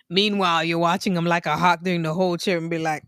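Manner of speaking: normal talking voice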